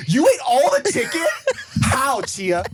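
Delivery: high, loud incredulous accusation; mock panic, slight anger. Retelling wife's shock at the missing chicken, panicky. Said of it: "It was panic and slight anger".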